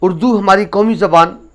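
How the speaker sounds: ordinary delivery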